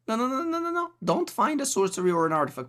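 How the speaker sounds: rapid-fire squeaky no-no-no; urgent high-voiced begging; high-pitched pleading. Real, not acted. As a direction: plead against the bad draw, high. Begging opponent's draw to miss.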